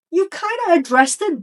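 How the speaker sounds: normal talking voice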